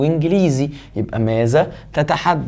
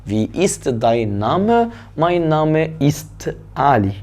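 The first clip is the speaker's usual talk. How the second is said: slow dictation; much slower than usual. Shown as a demonstration. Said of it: "slow model of question and answer".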